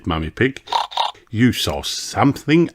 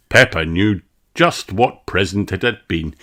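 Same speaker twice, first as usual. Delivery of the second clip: ordinary delivery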